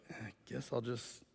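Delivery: very soft, low muttering; resigned. Acted. Healed man gives in and lugs his mat, resigned. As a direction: give in under your breath.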